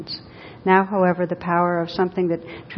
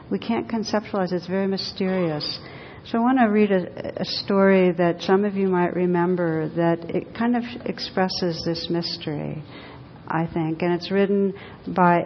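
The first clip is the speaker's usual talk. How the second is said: ordinary talk, nothing marked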